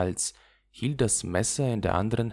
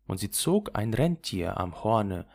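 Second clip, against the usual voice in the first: plain reading voice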